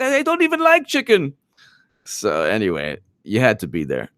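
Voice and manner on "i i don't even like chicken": put-on much higher, fast Eastern European accent; hammed-up Eastern European complaint. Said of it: "'cause she was like".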